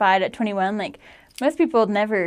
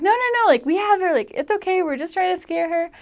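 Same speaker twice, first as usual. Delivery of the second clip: embarrassed parents; 9 semitones higher than usual. Retold or imitated. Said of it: "They were like".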